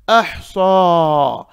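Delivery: अहसा with a long heavy emphatic s; very slow, stretch अहसा; drawn-out heavy sa. Shown as a demonstration. Teacher drawing out अहसा for pupils. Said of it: "सौद भी मोटा पढ़ेंगे".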